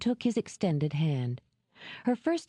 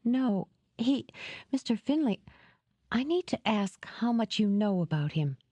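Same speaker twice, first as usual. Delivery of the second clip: higher, halting, mildly distressed; falter, then press on with the question; a touch of disgust in a raised voice; edge of revulsion; 5 semitones higher than usual. A narrator's reading. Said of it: "rusty edge of revulsion into her voice".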